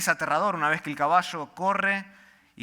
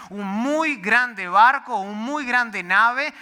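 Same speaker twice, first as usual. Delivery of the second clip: "muy grande" stretched out slow and louder; slow emphasis; 6 semitones higher, 8 dB louder, much slower than usual. Acted. Pastor stresses the ship's size to the congregation, slow and emphatic. Contrasting the sizes.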